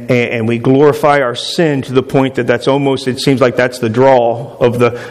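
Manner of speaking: usual speaking voice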